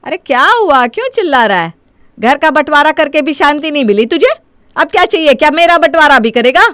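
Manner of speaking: loud, high-pitched angry rebuke; exasperated scolding. Acted. Mother fed up with son after property division, exasperated scolding. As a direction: snap back at a demanding son.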